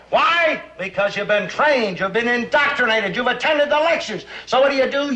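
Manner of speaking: normal talking voice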